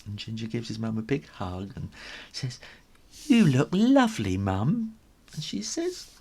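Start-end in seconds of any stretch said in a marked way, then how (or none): 3.3-4.9 high, louder fond compliment; adoring little son; sweet kitten voice. Acted. Ginger compliments mum.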